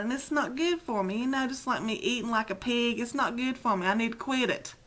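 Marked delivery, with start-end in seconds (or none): none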